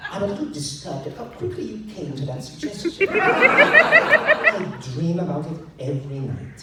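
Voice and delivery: can't hold back the laugh; laughing through it. Real, not acted. Emperor admitting his dream while the reader laughs.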